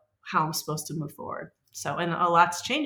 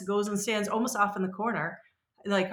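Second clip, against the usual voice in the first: ordinary talk, nothing marked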